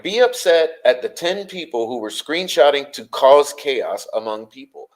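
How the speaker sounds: normal talking voice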